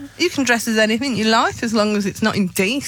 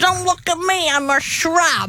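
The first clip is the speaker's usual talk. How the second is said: hammed-up New Jersey shrub; New Jersey accent; 5 semitones higher than usual. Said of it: "that's actually really good".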